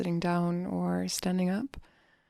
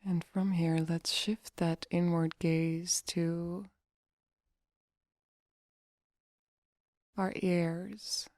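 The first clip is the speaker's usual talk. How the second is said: guided meditation. Acted. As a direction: guide them gently into relaxation, leaving long pauses.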